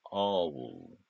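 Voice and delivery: usual speaking voice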